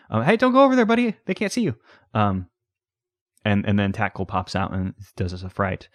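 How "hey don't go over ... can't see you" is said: much higher, louder pet-talk voice; cooing to a pet. Pretend. Warning the ghost pet away from danger in much higher baby talk. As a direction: coax a little pet like a baby.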